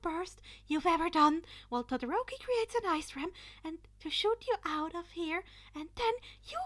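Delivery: urgent orders. Acted. Izuku urgently assigning roles to his classmates. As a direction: rush the plan out urgently.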